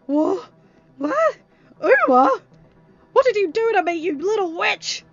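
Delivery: high, very loud startled demands; panicked outburst. Acted. Captive waking to face the witch, panicked yelling. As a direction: jolt awake and yell in alarm. Captive wakes confused among his enemies.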